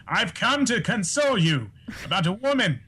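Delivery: theatrical actor impression, swooping high; grand, swooping declamation; Matt Berry. Shown as a demonstration. Guest declaiming for delighted hosts in a Matt Berry impression. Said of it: "hear some of your Matt Berries".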